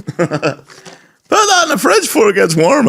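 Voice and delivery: high, slow mock old lady; confused granny. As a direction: drawl the granny's order in her voice. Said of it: "repeating granny quote".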